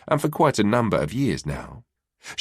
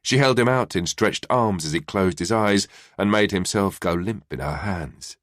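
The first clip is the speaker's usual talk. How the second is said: ordinary delivery, nothing marked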